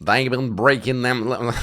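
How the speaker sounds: teasing Southern US accent impression; mimicking Southern accent. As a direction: imitate the South Carolina accent of the man in the clip. Mimics clip speaker, then says sorry.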